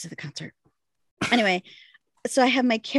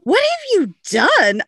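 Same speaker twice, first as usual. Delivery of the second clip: high, slow, hammed-up horror; the friend aghast at the mess; mock-appalled and drawn out; friend's alarmed reply; 9 semitones higher, 4 dB louder, slower than usual. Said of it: "And she's like".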